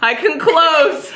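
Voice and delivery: high, drawn-out mock celebration; joking triumph. Shop owner joking she can shut up shop, gleeful. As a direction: stretch it out high, as a gleeful joke.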